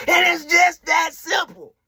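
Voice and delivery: triumphant shout. Real, not acted. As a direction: declare it proudly. Triumphant conclusion.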